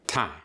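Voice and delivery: "Time" with one clean vowel, no glide; clean held vowel. Shown as a demonstration. Showing the transatlantic rule, clean unglided "Time". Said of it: "holds the vowels clean and separated".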